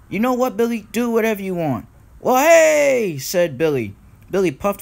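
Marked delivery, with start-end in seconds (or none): 0.1-1.9 high-pitched exasperation; raised storybook voice, fed up; exasperated Thomas. A narrator's reading. Thomas gives up arguing with Billy. 2.2-3.2 loud, high holler; high storybook cheer; excited whoop. A narrator's reading. Billy exclaims as he sets off.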